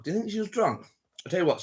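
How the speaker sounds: normal talking voice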